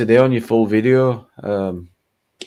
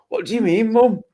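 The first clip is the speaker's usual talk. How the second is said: startled reply; 11 semitones higher than usual. Retold or imitated. Telling friends how mum quizzed [name], startled high reply. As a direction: act out being caught off guard by mum.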